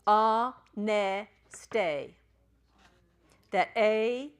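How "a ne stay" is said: "A, ne, stay" as three spaced beats, loud at first; syllable by syllable. Shown as a demonstration. Teacher repeats for the class, "A, ne, stay" syllable by syllable. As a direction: sound out "A, ne, stay", dropping on the last syllable. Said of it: "That's how you say that".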